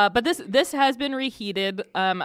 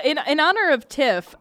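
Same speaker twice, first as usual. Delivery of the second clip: normal talking voice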